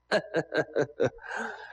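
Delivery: staccato gloating cackle; villain laugh. Acted. Bandit mocks the bound official's curses, staccato villain laugh. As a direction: answer with a run of even laugh bursts.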